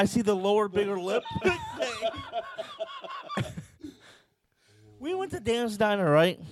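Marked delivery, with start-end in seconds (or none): 1.3-3.9 high, softer squeal of laughter. Real, not acted. Friend cracks up over the lip joke, squealing laugh.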